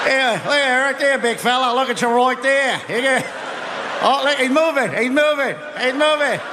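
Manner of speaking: high excited Aussie heckler; cheering on a stranded swimmer, Aussie voice; Australian accent. Retold or imitated. Mocking Australians at beach.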